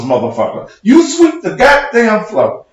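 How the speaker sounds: ordinary talk, nothing marked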